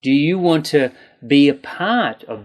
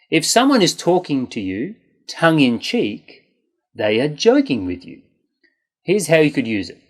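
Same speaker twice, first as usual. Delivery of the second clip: ordinary talk, nothing marked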